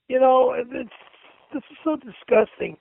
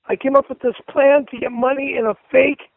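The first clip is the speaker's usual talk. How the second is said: ordinary delivery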